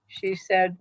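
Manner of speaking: ordinary talk, nothing marked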